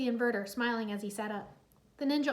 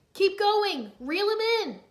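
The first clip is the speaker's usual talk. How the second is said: loud, higher urgent push; urging; 8 semitones higher, 8 dB louder, slower than usual. Acted. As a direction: drive the team on. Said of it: "Lloyd urged".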